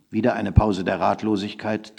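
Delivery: plain reading voice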